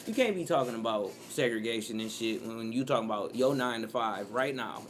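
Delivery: ordinary talk, nothing marked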